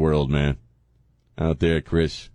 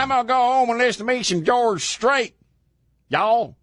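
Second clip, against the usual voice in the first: very high hammed-up Texas twang; teasing colleague Chris with an exaggerated Texas accent; mock Texan.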